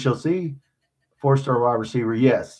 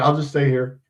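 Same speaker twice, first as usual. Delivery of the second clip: ordinary delivery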